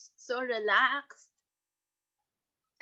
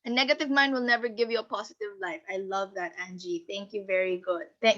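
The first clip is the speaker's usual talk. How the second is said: ordinary talk, nothing marked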